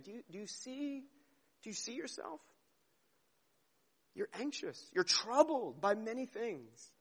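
soft, lifted voice, tenderly probing; gentle questioning. Acted. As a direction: help her see herself, very softly. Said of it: "That's what Jesus is doing to Martha".

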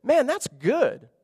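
high, slow, savoring "Man, that's good"; savoring God. Acted. God savoring his creation before the congregation, high and slow. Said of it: "He sees it and he savors it".

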